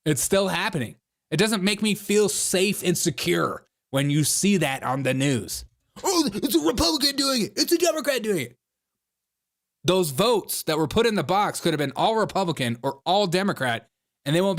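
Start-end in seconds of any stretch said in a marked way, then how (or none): 6.0-8.6 high, whiny mimicry of partisans; mock partisan whining. Host mimicking partisans blaming each other, mocking high voice. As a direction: put on a silly high voice for the bickering.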